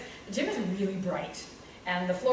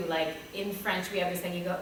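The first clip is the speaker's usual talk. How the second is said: ordinary delivery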